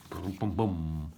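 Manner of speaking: very low, soft, slow boom boom boom; deep machine thuds; low engine booms. A narrator's reading. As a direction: rumble like a big digger engine. Digger engine noise.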